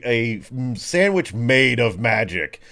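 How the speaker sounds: ordinary talk, nothing marked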